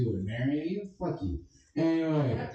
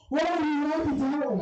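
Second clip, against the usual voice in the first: Danny Archuleta impression. Comic mocking a rival, high squeaky voice. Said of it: "my impression of Danny Archuleta sucking".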